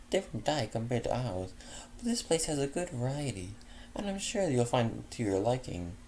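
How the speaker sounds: a generous hostess, lightly voiced up; kindly refined mare offering dinner; Fleur's voice.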